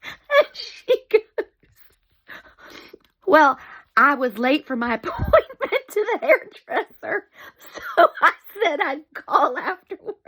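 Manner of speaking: high, squeaky laughter through the words; helpless laughing. Real, not acted. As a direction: fight to deliver the punchline while cracking up. Cannot hold laughter at punchline.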